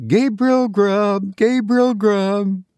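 high, drawn-out eerie chant; wild screaming chorus. A narrator's reading. Goblin voices filling the churchyard, chanting high. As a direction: wail the name slowly, pitched high. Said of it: "Again the voices replied".

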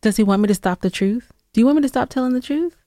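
normal talking voice

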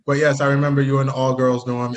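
ordinary delivery

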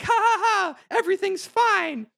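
airheaded happy hero brushing it off; dopey cheer. Retold or imitated. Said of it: "And Sora's like".

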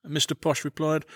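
ordinary delivery, nothing marked

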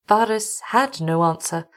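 plain reading voice

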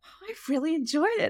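high, fast, surprised compliment; mock surprised praise. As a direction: imitate someone caught off guard. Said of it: "they're like".

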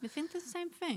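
soft, high-pitched British accent attempt; British film accent. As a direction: imitate a British accent, th as f, softly. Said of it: "like a F sound on the T H".

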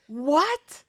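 high-pitched, incredulous rising question; mock-shocked, voice leaping up; re-enacted disbelief. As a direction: act out a stunned double take. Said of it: "Oh, I was like".

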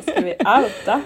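mimicking a friend's whisper through a laugh; laughing while copying her friend's teasing question; laughing imitation. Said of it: "Det är därför [name] bara".

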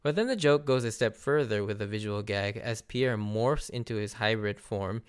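ordinary talk

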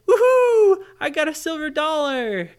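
high, loud whoop of triumph; whoop, then crow over the prize; excited cheer. Acted. Child finds a silver dollar, cheering in excitement.

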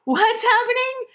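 high-pitched confused question; retold bewilderment. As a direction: act out your own baffled panic. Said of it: "my brain was not there".

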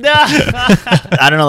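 high squealing laugh through words. Real, not acted. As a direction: giggle the words out slowly. Laughing at a friend's joke.

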